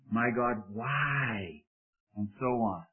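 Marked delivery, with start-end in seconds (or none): 0.1-1.6 "why" drawn out long after a short "My God"; stress on WHY. Shown as a demonstration. Preacher showing the emphasis exercise, stress on "why". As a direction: lean into the word "why" and stretch it. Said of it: "emphasize each word over and over".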